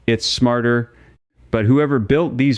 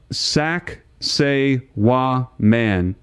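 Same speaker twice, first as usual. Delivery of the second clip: very slow, syllable by syllable; drawn-out Sacsayhuaman with pauses between the parts; said slowly; much slower than usual. Shown as a demonstration. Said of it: "Say it slowly".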